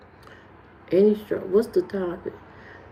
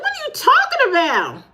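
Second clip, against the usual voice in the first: high indignant; 13 semitones higher than usual. Real, not acted. Indignant reply to chat.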